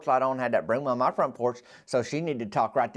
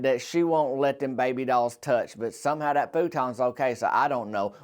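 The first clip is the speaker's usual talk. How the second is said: normal talking voice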